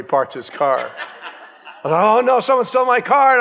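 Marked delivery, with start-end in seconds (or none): 2.0-3.4 high, loud panicked cry; freaking out. Retold or imitated. Pastor thinks his car is stolen, high panicked cry. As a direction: blurt it out in a rush of alarm. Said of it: "And I'm all freaking out".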